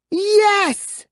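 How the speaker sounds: high triumphant yes; boy cheering at being home. A narrator's reading. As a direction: punch the air with it. Boy celebrating return home.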